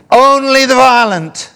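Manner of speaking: high, very loud preacher's cry; sudden full-voiced proclamation; emphatic shout. Real, not acted. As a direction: belt it out as the key phrase. Preacher shouts the key phrase.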